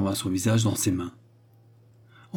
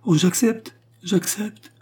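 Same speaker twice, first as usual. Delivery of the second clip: very high, overjoyed acceptance; ecstatic, breath-caught yes; wild rapture; 11 semitones higher, slower than usual. A narrator's reading. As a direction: accept as if carried away with joy. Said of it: "dit André, folle et transportée".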